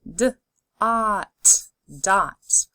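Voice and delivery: d, ot, dot, parts pulled apart then joined; D, ot, dot: each part separate, then the whole word; segmented then blended. Shown as a demonstration. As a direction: sound it out and blend it. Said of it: "Say the sounds with me and blend together".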